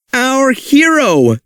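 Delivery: high, slow cheer. Acted. Cave aliens cheering Rex, high slow cheer. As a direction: cheer him on with delight. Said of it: "called the cave aliens".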